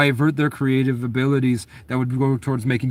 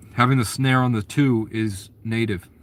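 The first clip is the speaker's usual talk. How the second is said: ordinary talk, nothing marked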